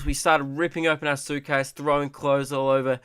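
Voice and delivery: usual speaking voice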